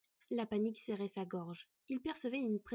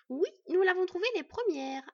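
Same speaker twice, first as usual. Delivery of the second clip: high, loud, forceful protest; vehement; 9 semitones higher, 9 dB louder than usual. A narrator's reading. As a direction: insist with all your force. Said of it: "avec véhémence".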